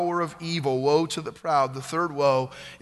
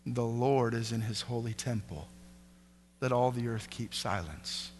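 hushed reverence; 6 semitones lower, 9 dB quieter than usual. Acted. God answering Habakkuk, hushed and reverent. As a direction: let it fall almost to a hush.

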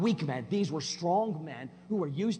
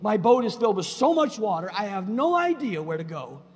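1 imitating a desperate believer; 4 semitones higher, 8 dB louder than usual. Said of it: "where you stood and said".

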